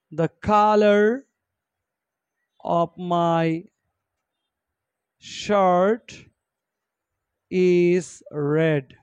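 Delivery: very slow, one word at a time; slow dictation. Shown as a demonstration. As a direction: read it out slowly for copying. Teacher dictating example sentence. (ধীরে ধীরে শ্রুতলিপি)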